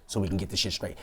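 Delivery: low, hushed confidential retelling; soft, discreet near-whisper. Retold or imitated. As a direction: drop the voice, keep it between us. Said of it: "I'm actually whispering to you".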